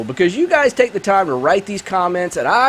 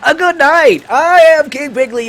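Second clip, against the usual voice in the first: hammed-up host intro; 6 semitones higher than usual. Host persona grand intro.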